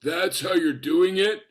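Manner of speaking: slow, incredulous imitated question; Super Dave. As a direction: draw it out in mock disbelief. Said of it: "Super Dave just looks at me".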